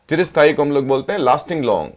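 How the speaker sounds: ordinary talk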